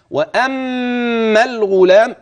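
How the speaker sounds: slow chanted phrase; slow Quranic recitation. Shown as a demonstration. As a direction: chant it slowly. Recites the verse.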